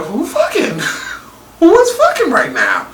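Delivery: high, laughing disbelief; shocked laughing. Retold or imitated. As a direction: squeal it through a laugh. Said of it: "and I'm like".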